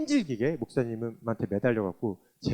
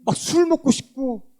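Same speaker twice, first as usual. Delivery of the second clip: loud, higher, fervent testimony; preaching; 7 semitones higher, 10 dB louder than usual. Acted. Testifying to the congregation, fervent loud preaching. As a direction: proclaim it with full force.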